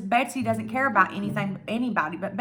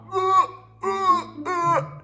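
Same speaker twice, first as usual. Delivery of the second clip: mock retching grunts, pitched much higher; imitated dry heaving; 9 semitones higher, slower than usual. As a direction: imitate someone gagging at a smell. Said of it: "freaking dry heaving".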